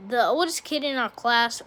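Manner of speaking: plain reading voice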